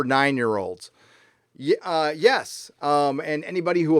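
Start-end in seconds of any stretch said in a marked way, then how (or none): none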